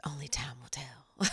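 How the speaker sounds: soft, breathy, dramatic whisper. Real, not acted. Host teases listeners about her future, in a dramatic whisper. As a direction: hush it like a secret.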